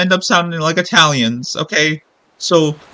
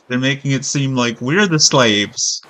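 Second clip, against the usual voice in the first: ordinary talk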